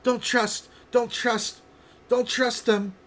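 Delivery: very high, loud frantic warning; shrieky, agitated character voice; paranoid companion. Acted. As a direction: cut in with panicky, insistent cries. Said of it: "the other one".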